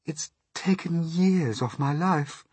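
high, slow ladybird voice; high, drawn-out complaint; shaken ladybird. A narrator's reading. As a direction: lament it slowly as the ladybird.

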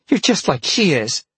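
put-on high, loud girl's accusation; accusing girl. A narrator's reading. Peg accuses him, glaring.

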